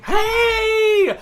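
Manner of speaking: high, loud whoop of greeting; excited shout. Real, not acted. Streamer spotting Susan just mentioned, excited shout. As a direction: call out in happy surprise.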